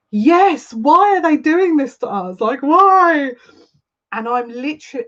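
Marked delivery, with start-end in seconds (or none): 0.1-3.3 emotional outburst. Real, not acted. Emotional outburst to viewer Jenny.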